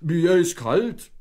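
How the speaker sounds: slow, loud shivering complaint. A narrator's reading. Wet husband in a towel, shivering. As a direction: shiver through the words. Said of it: "schlotterte er".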